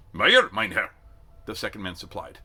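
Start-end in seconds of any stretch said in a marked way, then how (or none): 0.1-0.9 loud German answer; German recruit. A narrator's reading. German recruit gives his name to the sergeant, loud. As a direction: state it smartly in German.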